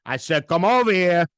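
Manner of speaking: loud mock-angry parental order; stern, threatening dad. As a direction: imitate a dad who means business. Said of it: "his tone of voice just knew".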